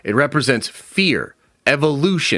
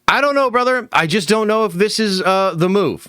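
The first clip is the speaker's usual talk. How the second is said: lightly voiced pundit, pitched higher; doubtful pundit; 7 semitones higher than usual. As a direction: play a hesitant pundit in a higher voice. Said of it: "if I put my pundit hat on".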